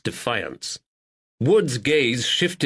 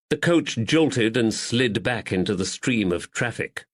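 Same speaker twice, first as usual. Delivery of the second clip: usual speaking voice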